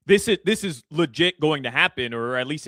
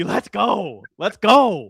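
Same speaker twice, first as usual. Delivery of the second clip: higher, louder victory cry; excited shout; 5 semitones higher, 6 dB louder, much slower than usual. Real, not acted. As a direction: yell it for the team. Celebrating the win.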